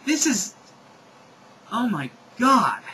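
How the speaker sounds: high, loud, halting exclamation; exasperated oh-my-god; appalled disbelief. Real, not acted. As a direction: react aghast at what you just read. Reader appalled at the text.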